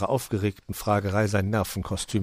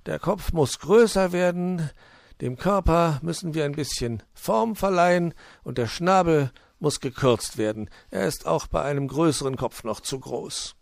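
higher-voiced fox craftsman, emphatic; animated fox; 8 semitones higher, 4 dB louder than usual. A narrator's reading. Fox judging a carved figure, animated and high. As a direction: voice a fox busily assessing his work.

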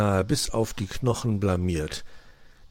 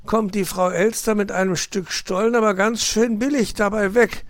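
high, animated grumble of a fox uncle; grumbling; 12 semitones higher, 5 dB louder than usual. A narrator's reading. Uncle fox to nephew about his meagre pay, grumbling. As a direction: grumble with indignant energy. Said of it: "murrte Herr Fuchs".

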